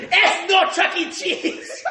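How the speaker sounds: high, loud broken-English shout; boss yelling. Retold or imitated. The boss grabbing him by the collar, high mock shout. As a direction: bark it out high like the angry boss. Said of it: "come and go".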